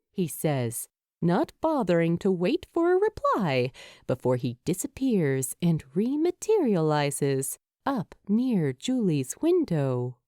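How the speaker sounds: ordinary delivery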